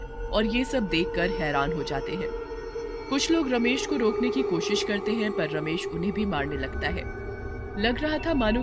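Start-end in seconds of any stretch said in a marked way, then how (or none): none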